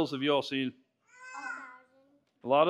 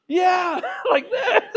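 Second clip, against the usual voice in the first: laughing; 5 dB louder, slower than usual. Real, not acted. Laughing after acting it.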